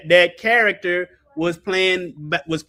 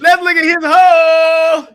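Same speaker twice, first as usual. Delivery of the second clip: teasing; 9 semitones higher, 7 dB louder, slower than usual. Real, not acted. Joking, drawn out.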